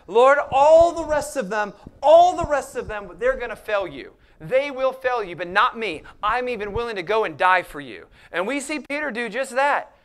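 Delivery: loud, ringing pulpit delivery; full-force preaching. Acted. Pastor driving home Peter's boast, loud fervent preaching. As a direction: preach it out to the back rows.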